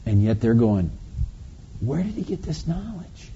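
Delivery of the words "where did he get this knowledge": hushed, high-pitched mock puzzlement; puzzled onlookers. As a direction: imitate baffled onlookers asking softly among themselves. Voices the puzzled onlookers' question.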